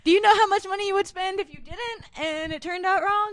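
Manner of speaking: high, loud and excited. Real, not acted. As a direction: pitch it up, worked up. Exclaiming about Plan B cost.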